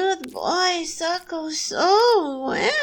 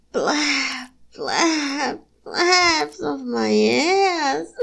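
normal talking voice

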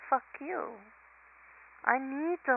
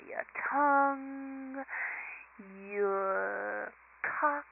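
slow sulky pouty voice with rounded lips; 8 dB louder, slower than usual. Acted. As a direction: pout each word out, needy and drawn. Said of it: "But hell, I'll do it anyway".

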